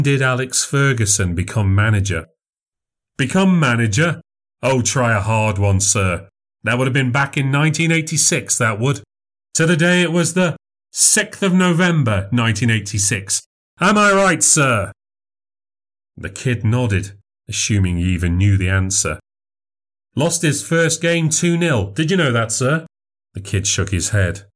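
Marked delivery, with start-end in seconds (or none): 3.2-14.9 higher, bright showman voice performing for a crowd; showman memory act. Acted. Narrator voices the man memorizer. 20.2-22.9 higher, bright showman voice performing for a crowd; showman memory act. Acted. Memorizer quizzing the kid.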